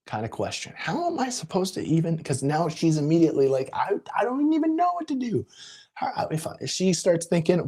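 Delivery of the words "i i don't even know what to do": much higher, a mock-helpless clerk; bewildered clerk. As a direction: imitate a clerk who is at a loss.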